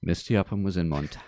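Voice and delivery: ordinary delivery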